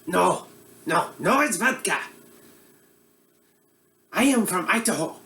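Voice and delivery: put-on comic Eastern European accent as a bumbling TV cook; broad Russian accent; mock Russian accent. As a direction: play a hammy Russian-accented chef host. Comedic Boris cooking show character.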